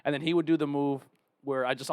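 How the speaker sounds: usual speaking voice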